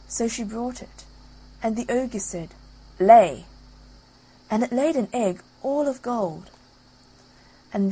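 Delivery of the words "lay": barked order; one loud stern word to the hen; ogre's loud command. Acted.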